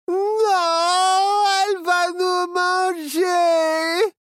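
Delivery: very high, loud, drawn-out wail; terrified crying shout. Acted. Children think the spider will eat them, terrified crying wail. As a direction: wail in terror of being eaten.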